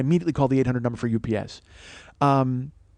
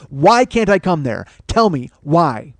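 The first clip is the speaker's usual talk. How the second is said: "Why" hit hard, each word slow and separate; slow, forceful insistence; slow demand; 5 dB louder, slower than usual. Retold or imitated. Retold angry phone demand.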